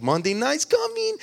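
high, rising excited squeal of words; excited daughter. Retold or imitated. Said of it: "she's all excited about it".

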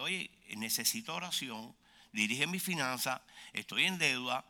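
soft, slowed, low-key plea; subdued mock pleading; acted humble request. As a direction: quietly ask the pastor for help. Acting congregant asking pastor for prayer.

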